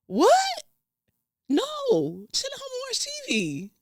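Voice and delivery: high shrieking what?; outraged disbelief. Real, not acted. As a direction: squeal it, offended. Outraged at his content question.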